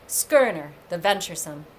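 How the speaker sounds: ordinary delivery, nothing marked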